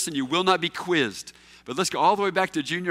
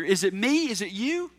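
ordinary talk

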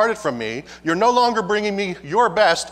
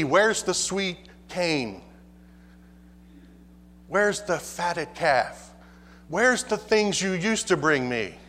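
slow lament; 3 dB quieter, slower than usual. Acted. God asking for offerings.

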